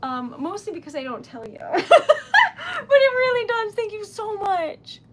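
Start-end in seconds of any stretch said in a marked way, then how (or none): 1.6-5.0 high, loud laugh-cry burst easing into gratitude; giddy, tearful high-pitched thanks; overwhelmed laughter. Real, not acted. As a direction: laugh it out high, nearly crying. Overwhelmed, laughing and near tears.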